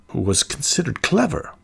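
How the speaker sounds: ordinary delivery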